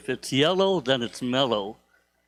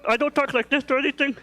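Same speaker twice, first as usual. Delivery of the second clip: much higher put-on voice; mock unclear enunciation; 11 semitones higher than usual. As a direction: put on a high-pitched voice, mocking poor enunciation. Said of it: "I'm able to enunciate myself".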